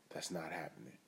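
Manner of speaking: usual speaking voice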